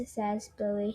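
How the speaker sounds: ordinary delivery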